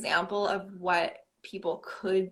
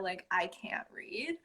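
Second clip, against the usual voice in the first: slow whispered confession; 8 dB quieter than usual. Real, not acted. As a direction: drop to a whisper. Confessing, voice drops.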